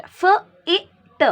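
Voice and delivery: "F-I-T" as separate sounds "fuh, ih, tuh"; phonic sounding out. Shown as a demonstration. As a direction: sound out "F-I-T" one phoneme at a time. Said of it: "The sound of I is E".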